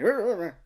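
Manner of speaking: loud, high garbled "arr" noises; mock startled garble. Imitating his reaction to pulsating back.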